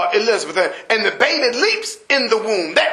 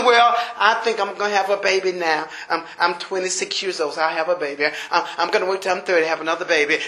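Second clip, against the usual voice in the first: voicing the congregation's excuse, lower and offhand; mocking would-be parent; 6 semitones lower, 4 dB quieter, faster than usual. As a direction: lower voice, casual and self-assured. Imagined parent planning babies.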